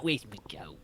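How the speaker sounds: whispered leprechaun. Retold or imitated. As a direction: hiss it like a lurking leprechaun. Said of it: "But not".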